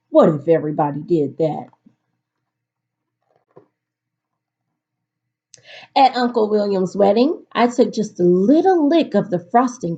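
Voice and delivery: put-on lively storybook read-aloud for kids; reading to children. A narrator's reading. Teacher reading a picture book to her class, lively storytelling.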